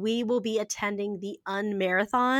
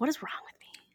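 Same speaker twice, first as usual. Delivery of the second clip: whisper; 6 semitones higher, 5 dB quieter, faster than usual. Retold or imitated. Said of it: "I was like".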